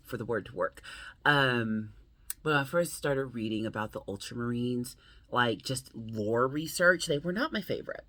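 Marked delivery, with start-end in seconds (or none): none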